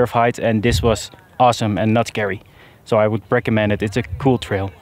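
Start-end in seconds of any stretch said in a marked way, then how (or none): none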